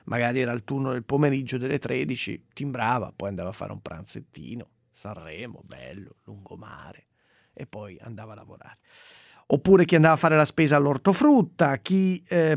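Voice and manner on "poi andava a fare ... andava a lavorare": whispering. Acted. Whispered aside about lazy workers.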